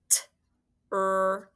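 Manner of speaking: "T-R-A-V" as four separate letter sounds, not letter names; sounded out. Shown as a demonstration. Teacher tapping a word for spelling pupils, "T-R-A-V" sounded out. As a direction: tap out "T-R-A-V" sound by sound, drawn apart. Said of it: "Those words are hard to tap".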